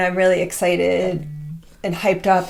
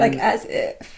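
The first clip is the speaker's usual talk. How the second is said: dismissive; 6 semitones lower, much slower than usual. Retold or imitated. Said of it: "convey this attitude".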